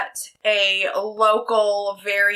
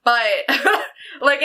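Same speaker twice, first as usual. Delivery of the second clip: ordinary talk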